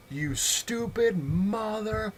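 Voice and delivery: high-pitched mock scream, dragged out slow; retold scream. Store owner screaming at the customer, mock scream. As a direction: screech it like the enraged owner. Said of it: "Just screams".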